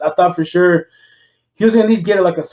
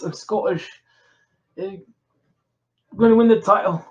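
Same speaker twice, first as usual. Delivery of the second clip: lightly put-on Scottish brogue, imitating the wrestler; Scottish accent; 3 semitones higher than usual. Friend imitates Drew McIntyre's Scottish accent. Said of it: "He talks like this".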